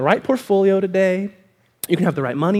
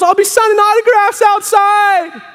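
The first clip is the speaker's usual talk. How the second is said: high, stretched-out triumphant call; hammed-up star's announcement; parting shout; 12 semitones higher, 5 dB louder, slower than usual. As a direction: yell it back to the crowd from the door. [name] shouts back from the door.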